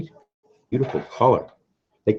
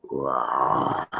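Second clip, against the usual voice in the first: mild roar; 9 semitones lower, 7 dB quieter than usual. Pretend. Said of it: "I hear a lion roaring".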